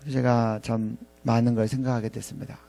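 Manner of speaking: ordinary delivery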